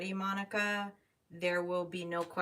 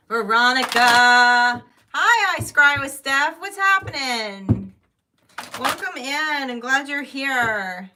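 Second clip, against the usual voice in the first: loud, high, welcoming hello; cheery greeting; 7 semitones higher, 18 dB louder, slower than usual. Real, not acted. Tarot reader loudly welcoming the next client. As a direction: greet them big and bright.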